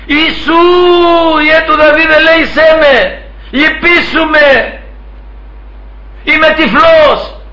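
high pleading call, long drawn-out words; acted crying out. Blind man ignores the crowd, begs Jesus, acted crying out. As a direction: keep calling high and slow, begging. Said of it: "Τι φωνάζεις".

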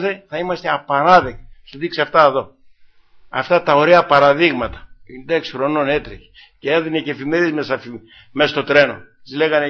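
usual speaking voice

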